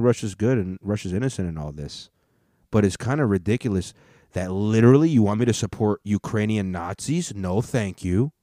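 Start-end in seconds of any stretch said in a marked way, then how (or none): none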